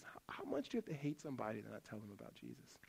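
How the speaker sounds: hushed challenge. Shown as a demonstration.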